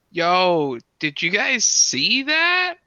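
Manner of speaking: high, loud, slow incredulous shout; loud call-out. Acted. Student calling out to the whole class, loud high shout. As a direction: yell it across the class, stunned.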